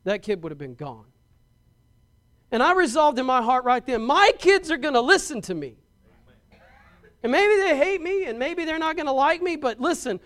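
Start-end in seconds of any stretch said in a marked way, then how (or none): 2.5-10.2 put-on louder, rising preacher's cry about his kids; fiery preaching. Preacher to the congregation on obeying parents, full-force preaching. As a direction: preach it hard and loud, building to the point.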